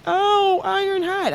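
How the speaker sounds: put-on a little boy realizing the iron is hot; son's discovery. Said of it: "he was like".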